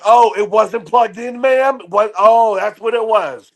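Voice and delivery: high, slow, mock-innocent gloat; smug gloating. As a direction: rub it in with fake surprise. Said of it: "I was waiting for the moment to be like".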